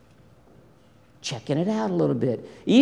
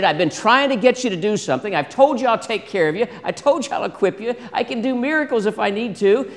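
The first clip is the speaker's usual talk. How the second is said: higher-pitched, mildly exasperated listing; a little frustrated; 6 semitones higher than usual. Acted. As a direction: remind them with growing frustration. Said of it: "a little frustrated".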